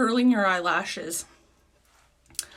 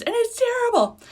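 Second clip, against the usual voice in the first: high, loud dramatic whine of despair; exaggerated wail; 7 dB louder than usual. Real, not acted. To viewers about her bad videos, high loud wail. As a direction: wail it like a disaster.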